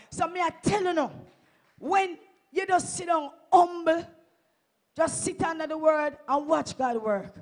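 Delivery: loud, rising call to the congregation; shouting the promise of God to the church; fiery preaching. Real, not acted. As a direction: preach it out with full force. Preaching at full force.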